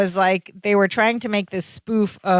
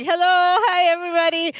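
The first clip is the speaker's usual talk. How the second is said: gleeful greeting; 9 semitones higher than usual. Retold or imitated. Podcaster voicing Balky greeting the game show camera, gleeful. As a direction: imitate an overjoyed greeting to the crowd.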